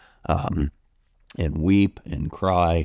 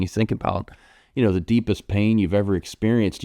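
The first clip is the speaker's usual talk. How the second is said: ordinary delivery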